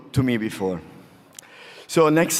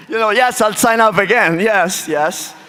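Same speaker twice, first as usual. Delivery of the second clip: excited; 7 semitones higher, 5 dB louder than usual. Real, not acted. Excited over surprise invitation.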